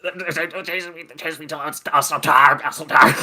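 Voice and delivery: high stammering mock voice; hammed-up exhausted plea; panicked crackhead. Mimics the panicked chased man.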